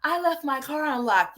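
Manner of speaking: hammed-up sing-song excuse; parodying the streamer's excuse in a mock voice; mocking imitation.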